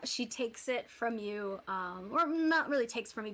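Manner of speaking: ordinary talk, nothing marked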